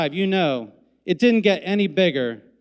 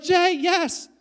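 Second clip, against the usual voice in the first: excited cheer; 10 semitones higher than usual. Retold or imitated. Cheering the caught foul ball.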